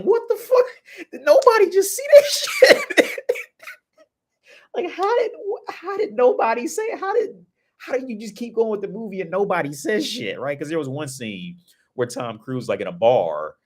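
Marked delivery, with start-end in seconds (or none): 0.0-10.4 sudden high squeal of disbelief; stunned disbelief. Retold or imitated. Viewer reacting to Vanilla Sky, shocked high yelp. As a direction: yelp it like the movie just broke your brain. Reliving bafflement watching the movie.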